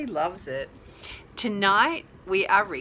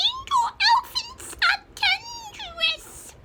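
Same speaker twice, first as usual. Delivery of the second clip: very high squeaky chick voice; tiny chick squawking a warning; 3 dB louder than usual. Acted. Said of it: "let me do that voice again".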